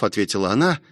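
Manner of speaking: ordinary delivery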